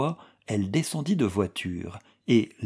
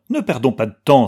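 loud, rallying exclamation; urgent cry; 3 semitones higher, 9 dB louder, faster than usual. A narrator's reading. Anna urges Manske not to lose time, crying out. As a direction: pull together and urge action. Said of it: "s'écria-t-elle en se remettant".

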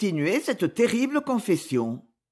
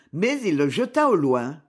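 ordinary delivery, nothing marked

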